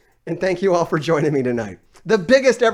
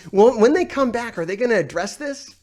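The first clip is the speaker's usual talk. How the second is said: ordinary delivery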